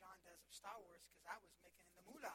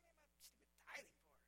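put-on annoyed kid; 5 semitones higher, 11 dB quieter, much faster than usual. Kid snapping back at dad.

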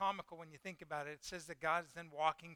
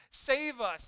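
higher, louder mock plea; mock panic; 5 semitones higher, 5 dB louder than usual. Sailors praying to their gods, acted panicked plea. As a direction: imitate a panicked cry for help. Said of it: "start freaking out".

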